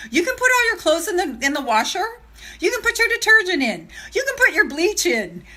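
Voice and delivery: high, lively list with a laugh; playful high listing. Real, not acted. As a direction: rattle off the steps brightly. Listing laundry steps playfully.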